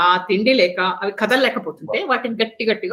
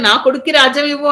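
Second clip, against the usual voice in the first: quick, louder, higher insistent demand; mock demand; 5 semitones higher, 5 dB louder, faster than usual. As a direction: demand the throne for her son, briskly. Imagined demand to the king.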